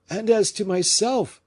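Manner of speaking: high, urgent question; anxious cry. A narrator's reading. Fouquet anxiously pressing d'Artagnan, high urgent cry. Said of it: "cried Fouquet".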